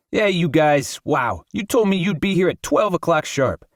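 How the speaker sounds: lightly voiced agitated poet, outraged at the delay; indignant Raphael. Raphael complains friends came late.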